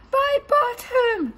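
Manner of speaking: high, loud, slow cry of alarm; dismayed cry. Acted. Robot discovering his missing bottom, high alarmed cry. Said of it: "cried Bernard".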